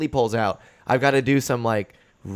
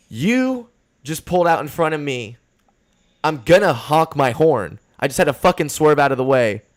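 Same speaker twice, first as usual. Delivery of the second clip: heated retort; 4 semitones higher, 4 dB louder than usual. Retold or imitated. Young driver confronting the old man, indignant shout. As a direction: tell him off for cutting you off. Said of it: "I was like".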